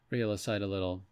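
ordinary delivery

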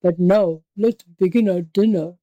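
menacing savage tiger voice; growling tiger. A narrator's reading. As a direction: growl it like a hungry beast. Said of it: "growled the savage beast".

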